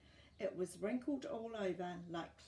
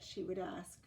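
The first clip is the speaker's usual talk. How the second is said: ordinary delivery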